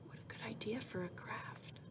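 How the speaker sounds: hushed whisper to the class; whispered aside. Acted. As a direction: lean in and whisper the praise. Hushed aside to kids while reading.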